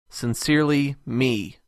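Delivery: ordinary talk, nothing marked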